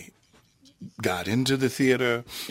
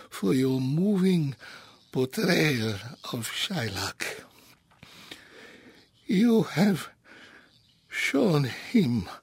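put-on high, pleading old rabbi; a humble old rabbi begging to be heard; elderly rabbi voice; 6 semitones higher than usual. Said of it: "I'll do both voices of the rabbi".

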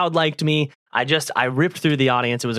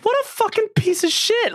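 high-pitched mock rant; angry outburst. Comedian retelling how he cursed the other comic. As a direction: shriek the insult in disbelief. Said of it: "and I was like".

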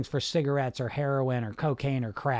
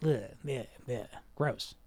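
soft, grossed-out mutter, quieter; disgusted; 5 semitones lower, 8 dB quieter than usual. Pretend. Coach imitating a listener's disgust at a junkie, soft and grossed out. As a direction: recoil from the thought with an ugh. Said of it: "you get a negative".

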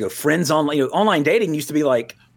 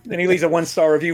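normal talking voice

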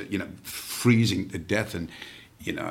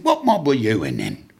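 brash guardsman; 7 dB louder than usual. Retold or imitated. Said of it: "He said".

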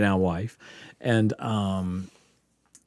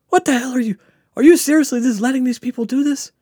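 imitating the angry doctor, much higher and louder; 6 dB louder than usual. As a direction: snap at the students like a furious little doctor. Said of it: "And he's so angry".

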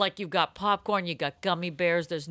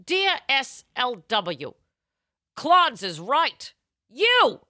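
stern columnist reply; 5 semitones higher, 4 dB louder than usual. Acted. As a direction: read it as a sharp rebuke from the advice column. Reads the columnist's stern answer.

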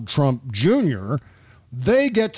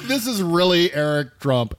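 ordinary talk

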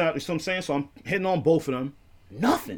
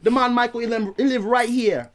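higher, imitated Jamaican patois; terrible Jamaican accent; 5 semitones higher than usual. Neighbour says where the man lives, terrible Jamaican accent. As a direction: confirm it as a badly imitated Jamaican. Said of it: "This is a terrible accent".

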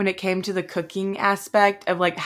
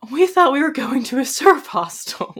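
higher-pitched, bewildered protest; retold dismay; 7 semitones higher than usual. Guests told of crocodiles and rip currents, mock dismay. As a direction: replay the let-down in a raised voice.

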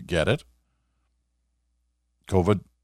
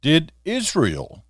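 upbeat coming-up-next read; announcer tease; 6 semitones higher, 5 dB louder, slower than usual. Acted. Show tease before break.

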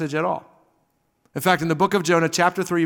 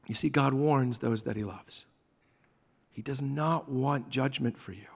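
soft, low, intimate warning; hushed sermon; 6 semitones lower, 7 dB quieter than usual. Real, not acted. Pastor telling the congregation God warns those he loves, hushed. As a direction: draw them in, almost whispering. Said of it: "hushed sermon close".

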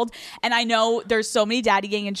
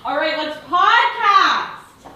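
drawn-out, higher-pitched cheer; hyped kickoff; 7 semitones higher, 3 dB louder, much slower than usual. Real, not acted. Host kicking off the podcast, hyped and drawn out. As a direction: stretch it out like a rallying call. Hyping the start of recording.

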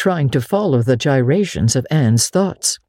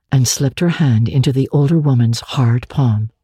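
plain reading voice

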